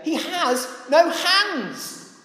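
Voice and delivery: high-pitched, incredulous scoffing; acted-out insolent jeering in a high voice; rude mockery. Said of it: "Even ruder shall the handiwork say".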